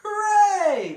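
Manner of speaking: hooray cheer. Acted. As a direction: burst out in joy together. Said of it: "they said".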